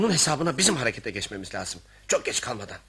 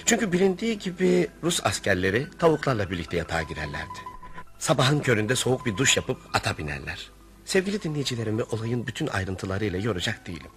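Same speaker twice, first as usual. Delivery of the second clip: calm storyteller narration over music; story narration; 6 semitones lower than usual. A narrator's reading. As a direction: tell it to the listeners calmly. (müzik eşliğinde sakin anlatıcı sesi)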